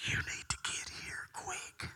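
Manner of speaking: hushed, unvoiced whisper; urgent whisper. Woman calling the pastor over, urgent whisper.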